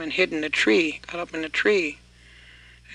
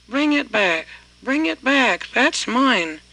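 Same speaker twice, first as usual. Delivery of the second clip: higher and louder, calling after the thief; hollering; 6 semitones higher, 5 dB louder, slower than usual. Acted. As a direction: holler it back at the boy, demanding. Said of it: "that man hollered".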